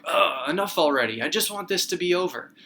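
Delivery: loud, fed-up outburst with a groaned "Ugh"; acted exasperation. Teacher voices people tired of waiting out the pandemic, exasperated. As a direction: raise the pitch and blurt it, fed up. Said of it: "we just kind of are like".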